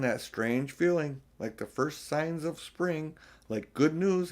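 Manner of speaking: plain reading voice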